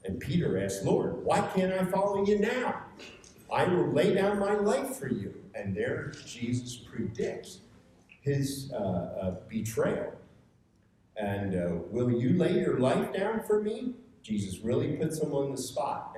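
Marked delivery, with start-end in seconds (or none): 0.8-5.3 high, loud pleading protest; a disciple insisting he'll follow now; earnest protest. Acted. Peter protesting to Jesus at supper, high and pleading. 11.9-13.9 challenging question. Acted. Jesus challenging Peter.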